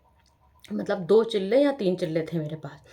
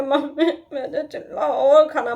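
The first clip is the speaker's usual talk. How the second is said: whiny plea; 6 semitones higher, 5 dB louder, slower than usual. Retold or imitated. Begging mother for more.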